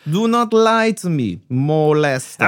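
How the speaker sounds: hammed-up Russian villain gloating; spring the trap in a cartoon Russian accent; mock Russian accent.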